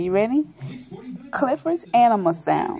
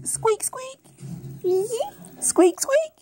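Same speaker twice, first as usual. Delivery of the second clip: high-pitched mouse squeaks; 12 semitones higher, 5 dB quieter than usual. Acted. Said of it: "The mouse says".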